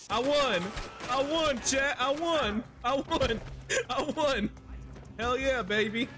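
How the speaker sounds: high-pitched victory cries; elated cheering. Real, not acted. Streamer telling chat about the win, elated. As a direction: crow it to the crowd, thrilled.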